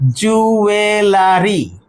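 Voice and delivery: জুয়েলারি drawn out slowly, ju-e-laa-ri. Shown as a demonstration. Teacher showing how we usually pronounce it, slow mispronunciation. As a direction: stretch every syllable of জুয়েলারি with a full "la". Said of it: "আমরা usually প্রনা".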